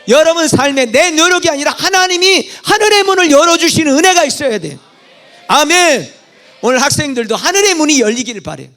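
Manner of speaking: loud, high blessing proclaimed from the pulpit; fervent preaching. Acted. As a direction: lift the congregation with a rousing blessing. Preaches blessing at full force.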